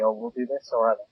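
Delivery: normal talking voice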